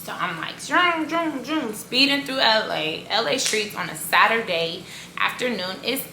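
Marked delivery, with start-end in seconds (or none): 0.6-1.7 high-pitched zooming car noise; playful high vroom sound effect; imitated car zoom. Imitating speeding car while retelling.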